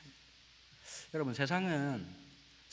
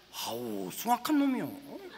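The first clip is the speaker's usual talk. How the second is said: put-on slow Chungcheong country accent, a touch awed; 충청도 사투리로 느릿하게; Chungcheong dialect; 3 semitones higher, slower than usual. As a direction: stretch it out like a Chungcheong villager. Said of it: "내가 충청도 말로 설교를".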